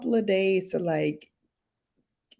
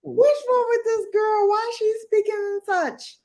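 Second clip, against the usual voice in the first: high-pitched mock puzzlement; exaggerated, very high incredulous voice; imagined onlookers' bafflement. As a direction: squeal it as a baffled colleague.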